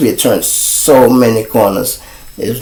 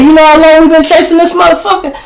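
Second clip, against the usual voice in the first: high, rapid, worked-up question; acted-out high-pitched complaint; retold exasperation; 4 dB louder, faster than usual. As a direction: fire it off in mock exasperation. Retold officer exasperated by chase.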